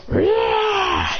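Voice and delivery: high-pitched attack yell; battle roar. Acted. Lucario slamming its foe down, high attack roar. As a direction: let out a high fighting roar.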